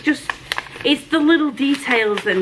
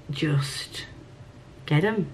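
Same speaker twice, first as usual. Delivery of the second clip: whispered urging; 5 semitones lower, 9 dB quieter, much slower than usual. Real, not acted. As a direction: whisper Just, slow and soft. Urging viewers to buy.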